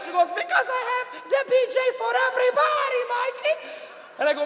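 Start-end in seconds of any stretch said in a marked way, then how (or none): 0.3-3.5 very high, wailing mother voice; imitated yelling mother. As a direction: wail at your son as a horrified Mom. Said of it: "Why are you yelling like that?".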